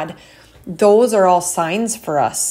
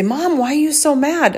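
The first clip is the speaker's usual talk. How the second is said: higher-pitched imitation of a child asking Mom; upset child; 8 semitones higher than usual. Kids asking mom why she's mad, imitated higher voice. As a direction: mimic a puzzled kid's raised-voice question. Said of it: "so they'd say".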